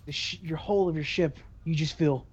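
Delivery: normal talking voice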